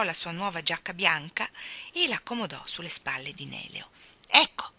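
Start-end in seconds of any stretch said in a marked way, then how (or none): 4.3-4.7 loud, curt burst of irritation; angry. A narrator's reading. Mary Poppins handing over her jacket, loudly irritated. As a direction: throw the word out crossly. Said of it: "commentò con rabbia".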